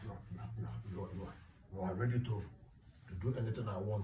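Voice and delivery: hushed, low pondering; low-pitched, halting murmur; low, measured musing. Acted. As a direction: weigh the offer quietly and low. Weighing up the desperate offer.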